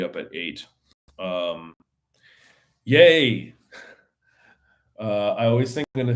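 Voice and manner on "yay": loud, high little cheer; mock cheer. Mock cheer after tired night.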